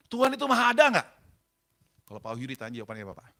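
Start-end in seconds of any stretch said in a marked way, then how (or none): 0.1-1.1 high, forceful acted demand; loud challenge. Husband testing the pastor about God, loud and challenging. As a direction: imitate a man firing a testing question.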